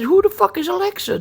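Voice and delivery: high-pitched, incredulous retold question; exasperated disbelief. Dad retelling a quizzing of his kid's mom, high exasperated disbelief. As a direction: pitch jumps up in baffled protest.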